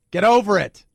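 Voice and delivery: loud, high, hammed-up shock; mock outrage. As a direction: play the scandalized scold. Host mocking outrage over joke.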